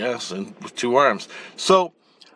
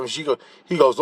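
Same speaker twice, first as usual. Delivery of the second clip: normal talking voice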